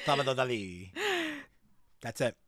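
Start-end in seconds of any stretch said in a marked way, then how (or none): 0.1-0.9 softer, badly faked Spanish accent. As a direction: try the name with a Spanish accent and miss. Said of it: "It's bad. It's all bad".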